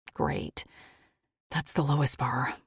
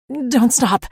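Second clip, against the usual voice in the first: sharp, louder and higher snap; snapping; 9 semitones higher, 11 dB louder than usual. A narrator's reading. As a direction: snap it at him urgently. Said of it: "I snapped".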